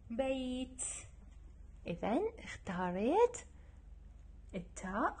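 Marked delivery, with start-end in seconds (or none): none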